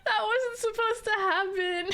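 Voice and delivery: high laughing squeak. Real, not acted. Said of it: "Not the voice crack".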